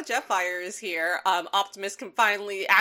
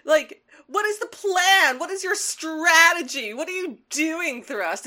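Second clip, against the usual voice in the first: mock anguished rant; 9 semitones higher, 4 dB louder than usual. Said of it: "He's just like".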